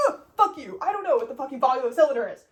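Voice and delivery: loud, fast, exasperated rant; retorting to the professor, frustrated and loud; angry outburst. Retold or imitated.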